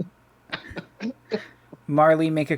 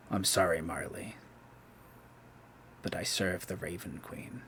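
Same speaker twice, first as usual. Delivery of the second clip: quiet sword voice; 6 semitones lower, 19 dB quieter than usual. Acted. Sword speaks, hushed voice.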